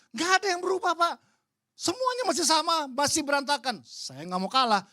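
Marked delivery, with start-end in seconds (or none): 0.1-3.8 much higher and louder angry insistence; angry retort. Retold or imitated. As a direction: fire it back furiously. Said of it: "Dia marah". (bantahan marah, jauh lebih tinggi dan keras)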